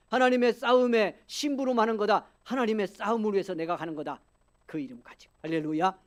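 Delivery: building to loud, high proclamation; impassioned preaching. Real, not acted. Preacher declaring he joins God's battle, impassioned preaching. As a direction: drive each declaration home to the congregation.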